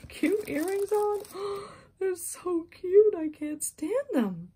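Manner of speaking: high, loud squeal of delight. Real, not acted. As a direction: gasp and squeal like a thrilled fan. Squealing over cute earrings.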